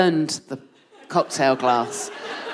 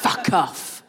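higher-pitched mock Welsh accent; 4 semitones higher than usual. Comedian correcting the man at the bar to Welsh accent. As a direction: redo it as a Welshman, put-on accent. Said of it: "he was Welsh".

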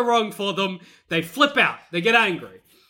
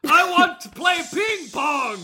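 high, slow monster shout; hammed-up Bigfoot wail; upset Bigfoot tantrum; 7 semitones higher, slower than usual. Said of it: "No, Bigfoot, calm down".

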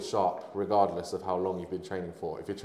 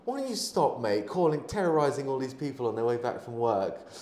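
mock indignant, high and loud; imitating a telling-off; 5 semitones higher, 3 dB louder than usual. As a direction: act out calling someone out in public. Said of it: "someone actually called him out".

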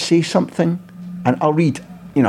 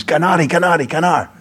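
mild mock chant, higher pitched; retold team scream; 6 semitones higher than usual. Everybody screams in the dressing room huddle, retold mild yell. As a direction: imitate a huddle's cry, only half shouted. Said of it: "and then everybody screams".

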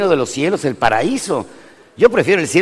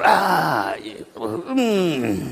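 groaning grunt; 3 semitones higher, 4 dB quieter than usual. Retold or imitated. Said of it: "El primer pujido con el despertador".

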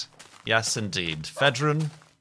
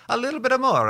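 much higher and louder, squeaked; squeaky high voice; 6 dB louder, much faster than usual. Pretend. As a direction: squeak it out comically. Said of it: "a spicy meatball".

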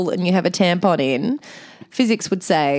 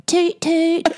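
put-on a higher playful kid's toot; toddler toot toot; 10 semitones higher than usual. Host imagining Ollie on your shoulder, lightly voiced toddler toot. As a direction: chirp it like a little boy. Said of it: "sitting on your shoulder going".

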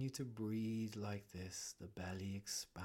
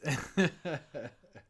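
bright laugh; 6 semitones higher, 12 dB louder than usual. Real, not acted. Laugh after joke.

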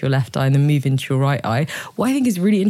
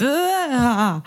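startled gasp; 8 semitones higher than usual. Retold or imitated. Shocked reaction to first eye gazing.

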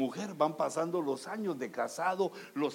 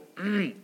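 deliberate throat clear; showy throat clear; 4 semitones higher, 5 dB louder than usual. Shown as a demonstration. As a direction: clear the throat before speaking. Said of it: "afina la voz".

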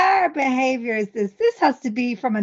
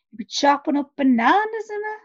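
usual speaking voice

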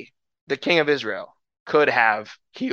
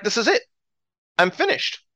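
higher-pitched mock despair; panicked king; 6 semitones higher than usual. King of Israel to those around him, panicked. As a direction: act out a king who thinks all is lost. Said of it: "the king freaks out".

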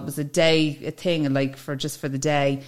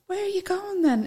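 much higher, puzzled questioning boss; 3 dB quieter than usual. Retold or imitated. Boss pressing where she's going, imitated higher and puzzled. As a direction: ask it in the boss's higher, baffled voice. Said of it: "And she said".

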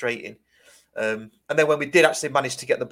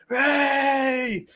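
long, high-pitched celebratory cheer; retold crowd cheer; 12 semitones higher than usual. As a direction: imitate fans cheering, high and drawn out. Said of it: "There was a bit of a cheer".

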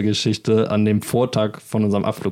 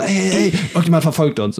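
higher, urgent mock alarm; alarmed warning; 6 semitones higher than usual. Paranoid friend in the back seat, higher alarmed warning.

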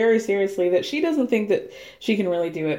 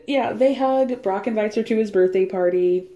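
ordinary talk, nothing marked

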